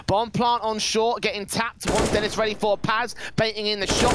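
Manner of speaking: usual speaking voice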